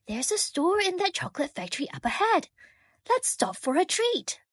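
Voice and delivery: a hint of an eager friend suggesting a treat; light voice for the man. A narrator's reading. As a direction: friend coaxing George to stop, lightly voiced and quick. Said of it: "the man said".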